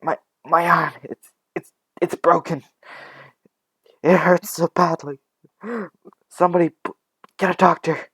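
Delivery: high, loud, stammering plea for help; pained, panicked cry; trembling in pain. Acted. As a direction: stammer through the agony, breaking up the words. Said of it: "she's just trembling in pain".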